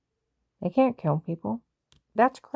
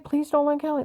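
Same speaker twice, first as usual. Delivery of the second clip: higher, pleading imitation of herself; a touch of mock desperation; retold pleading; 9 semitones higher than usual. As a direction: replay her own begging in a raised voice. She retells her own pleading.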